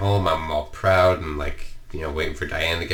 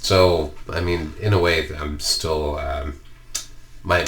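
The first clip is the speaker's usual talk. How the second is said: ordinary delivery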